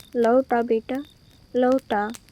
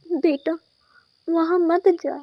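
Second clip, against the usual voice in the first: higher, urgent plea; anxious mother calling out a warning; worried mother; 5 semitones higher, 5 dB louder than usual. Acted. Mother begs her son not to go to the well, worried. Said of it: "तुम इतना क्यों डर रही हो".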